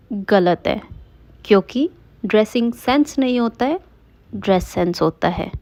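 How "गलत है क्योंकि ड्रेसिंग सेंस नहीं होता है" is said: ordinary talk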